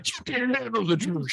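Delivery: co-host jokingly quotes the courtroom movie; Jack Nicholson line. Retold or imitated. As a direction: camp it up as the film line. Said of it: "Jesus would have responded that way".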